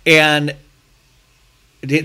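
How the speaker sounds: ordinary talk, nothing marked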